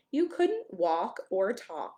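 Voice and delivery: usual speaking voice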